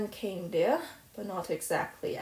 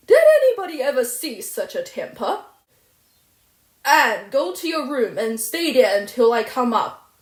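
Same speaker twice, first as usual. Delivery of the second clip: louder, sharp commands; stern loud scolding; 6 semitones higher, 11 dB louder than usual. Acted. Guardian sending the raging girl to her room, stern loud scolding. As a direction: order her out, shaken.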